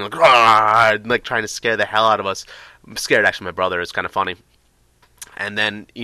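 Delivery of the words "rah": loud growled "Rah!" like a masked scarer; mock monster roar. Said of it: "a hockey mask going".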